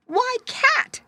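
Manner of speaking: put-on very high, thin old woman's cry; high-pitched shocked granny voice. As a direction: gasp it out as a startled old lady. Shocked old woman scolds cat.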